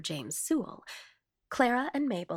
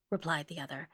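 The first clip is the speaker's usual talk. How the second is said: ordinary delivery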